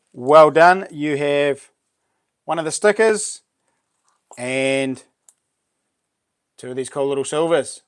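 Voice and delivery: ordinary talk, nothing marked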